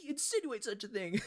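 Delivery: high-pitched mock outrage; hammed-up affronted squawk; offended, mad. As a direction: imitate him indignantly protesting, voice way up. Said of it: "He's so offended by it. He's so mad".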